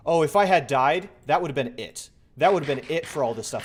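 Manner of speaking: loud shaken relief; loud relieved rant. Real, not acted. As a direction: blurt out the near miss. Relief after near death.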